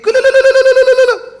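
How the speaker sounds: very high, loud repeated no; anguished shriek. Acted. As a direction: horror at burying his own new car. Man realizes he buried his gift. (צעקת אימה גבוהה ורועשת)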